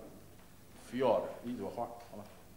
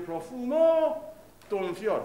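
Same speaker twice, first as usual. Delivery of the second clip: fiore leaned on, don light; stress on fiore; 3 semitones higher, 5 dB louder, much slower than usual. Shown as a demonstration. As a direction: sing don fiore with the weight on fiore. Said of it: "不是".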